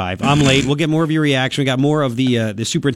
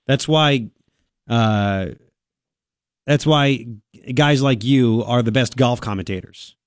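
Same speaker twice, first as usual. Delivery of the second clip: ordinary delivery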